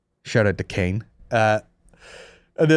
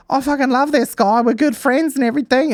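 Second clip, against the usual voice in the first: mock girly gush, pitched way up; gushing high voice; 12 semitones higher than usual. Podcaster imitating a reality show girl, high gushing. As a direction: gush about him in a high put-on voice. Mimicking Filipino contestant gushing.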